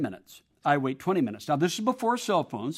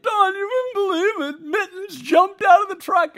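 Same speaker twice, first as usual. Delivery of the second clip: much higher, shouted wife's voice; upset wife; 13 semitones higher, 5 dB louder than usual. Retold or imitated. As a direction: cry it out to her husband. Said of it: "And she's like".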